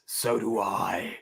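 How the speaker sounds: low, slow tough-guy line; gruff action hero. Acted. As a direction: growl it like a vigilante. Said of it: "Would be my script".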